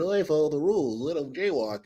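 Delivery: lightly voiced prim rule-follower; a hint of a prissy nerd. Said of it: "the nerd".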